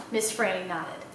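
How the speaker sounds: ordinary delivery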